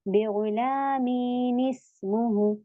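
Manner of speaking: slow, drawn-out chanted Arabic recitation; teacher chanting the verse slowly for her students; Quranic recitation. Shown as a demonstration. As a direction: recite it slowly, stretching each vowel. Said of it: "तो फिर हम इसको पढ़ेंगे".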